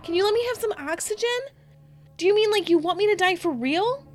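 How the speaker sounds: high, loud panicked pleading; Candace screaming. Retold or imitated. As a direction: beg in panic as if you're suffocating. Retold child pleads under pillows.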